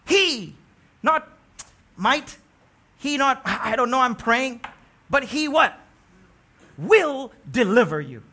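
loud, high pleading proclamation; loud ringing voice of the king; impassioned king's cry. Acted. Preacher voicing Darius's loud confession.

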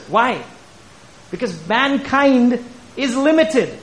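high, insistent sermon delivery, key words stretched; emphatic preaching. Real, not acted. Preacher explaining why the gospel is not man's, emphatic. Preacher drives home the point.

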